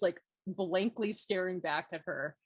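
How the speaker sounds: ordinary talk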